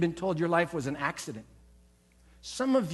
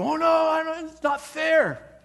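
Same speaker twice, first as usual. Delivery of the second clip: high, loud, drawn-out whine; mock wail at the unfairness; whiny protest; 10 semitones higher, 6 dB louder than usual. Preacher mocking a listener protesting to God, whiny and loud. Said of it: "You go".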